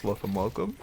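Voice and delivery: lightly voiced vampire welcome; a hint of Count Dracula greeting; transylvanian accent. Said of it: "but in a vampire voice".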